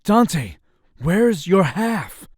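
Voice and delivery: loud, slow, demanding his half; angry demand. Acted. Roommate demanding his rent share, loud and slow. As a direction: call him out sharply.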